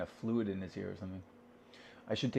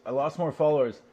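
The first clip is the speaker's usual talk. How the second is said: lost more followers, said louder and higher; louder, higher repeat; 4 semitones higher, 6 dB louder than usual. Shown as a demonstration. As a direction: punch it out the second time.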